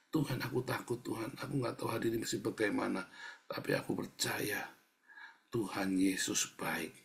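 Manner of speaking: soft, subdued plea ending in trust; quiet asking prayer. Shown as a demonstration. Preacher models admitting fear to God, softly pleading. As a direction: ask softly, not as a complaint. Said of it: "Bukan mengeluh, bilang, minta".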